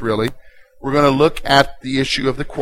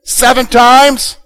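loud, high, fed-up questioning; put-upon complaint at raised pitch; exasperated Peter; 7 semitones higher, 7 dB louder than usual. Acted. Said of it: "here's what he's saying".

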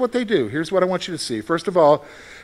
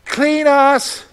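pleading prayer; 5 semitones higher, much slower than usual. Retold or imitated. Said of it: "They didn't even just say".

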